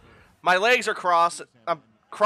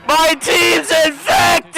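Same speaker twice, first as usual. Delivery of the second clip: slow, loud mock-tragic yell; outraged shout; 8 semitones higher, 6 dB louder, slower than usual. Real, not acted. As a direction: cry it out like a tragedy. Outrage at the pick.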